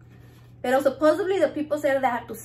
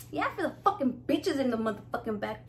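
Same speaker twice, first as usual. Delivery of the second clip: usual speaking voice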